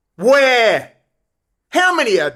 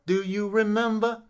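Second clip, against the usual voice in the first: quieter repeat; 8 dB quieter than usual. Shown as a demonstration.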